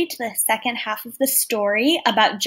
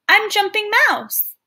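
mouse voice; 9 semitones higher, 3 dB louder than usual. Acted. Mouse introduces himself to bison.

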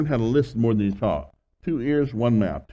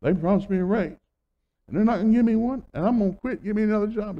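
higher-pitched, worked-up imitation of a daughter; indignant complaint; 10 semitones higher, faster than usual. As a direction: mimic an aggrieved daughter, pitched up. Daughter complains about raise.

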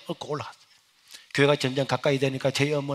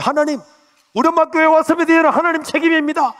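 much higher, loud pleading prayer; fervent prayer; 12 semitones higher, 10 dB louder than usual. Retold or imitated. Son praying at church for his mother, loud fervent prayer. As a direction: cry out to God with everything.

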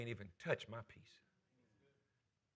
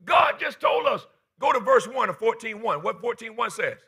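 loud, pounding preacher voice; full-force preaching; 10 semitones higher, 11 dB louder than usual. Acted. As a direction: drive it home to the church.

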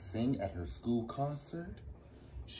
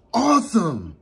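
loud; 12 semitones higher, 17 dB louder than usual. Acted. Ruby thrilled about singing.

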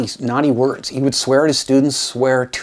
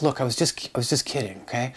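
softer, nervous backing down; nervous backpedal; 7 dB quieter than usual. Retold or imitated. Teacher nervously explaining himself to an angry colleague, softer. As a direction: hush it, trying to calm him down. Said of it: "I got a little bit nervous".

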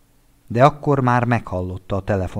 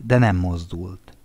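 usual speaking voice

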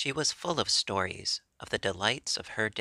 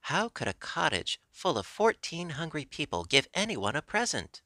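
higher-pitched, incredulous question; surprised exclamation; 6 semitones higher than usual. A narrator's reading. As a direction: exclaim it in mild astonishment. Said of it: "exclaimed Mistress Mary".

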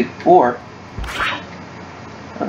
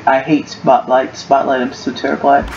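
ordinary delivery

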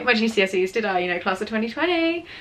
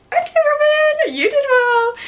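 high, loud squeal of self-congratulation; mock celebration; 8 dB louder, slower than usual. Student congratulating herself on exam results, high mock-excited squeal. Acting out her reaction to results.

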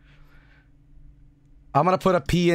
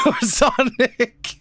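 laughing; 7 semitones higher, slower than usual. Real, not acted. Laughing through his words.

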